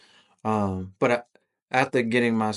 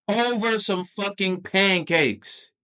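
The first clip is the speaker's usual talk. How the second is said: high, drawn-out exasperation; slow, incredulous emphasis; exasperated disbelief; 10 semitones higher, slower than usual. Real, not acted. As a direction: stretch it out high, appalled at the absurdity. Exasperated at own overreaction.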